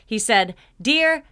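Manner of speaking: ordinary delivery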